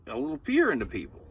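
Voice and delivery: ordinary talk, nothing marked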